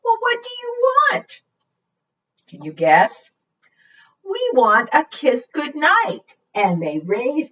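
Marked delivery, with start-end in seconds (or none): 0.0-1.4 high, pleading baffled question; high-pitched knight, mock exasperated. Knight at wits' end. 4.2-6.4 high, slow sweet request; high, slow sleepy dragons asking for a goodnight kiss. Acted.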